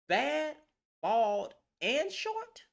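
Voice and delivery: mock disbelief. Real, not acted.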